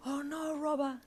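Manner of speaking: high, frightened squeak; inward panic. A narrator's reading. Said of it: "she panicked internally".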